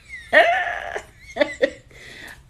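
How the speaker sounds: loud, high burst of laughter. Real, not acted. Laughing about talking to herself, a high burst. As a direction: cackle at yourself, high.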